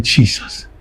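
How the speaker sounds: quoted exclamation in a soft whisper; softly breathed retold exclamation; retold hushed dismay. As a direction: whisper it as remembered shock. Quotes own horrified exclamation.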